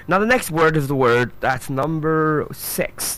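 ordinary delivery

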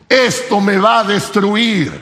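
normal talking voice

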